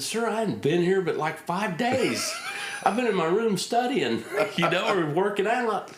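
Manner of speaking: high, laughing nervous excuse; laughing protest. Retold or imitated. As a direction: plead innocence while laughing. Nervous young pilot defending himself.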